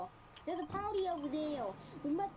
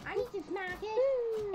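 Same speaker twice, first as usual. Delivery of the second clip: louder, higher "I need to smack it"; loud insistent; 5 semitones higher, 5 dB louder than usual. Real, not acted. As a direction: push it out eagerly and loud.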